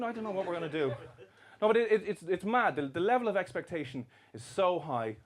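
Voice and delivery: usual speaking voice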